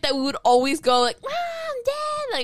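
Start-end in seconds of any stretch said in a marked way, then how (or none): 1.2-2.3 high mock-whiny kid call; calling for parents. Siblings wanting parents on their side, imitated high call. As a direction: call out high, like a tattling child.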